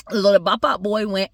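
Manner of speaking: ordinary delivery, nothing marked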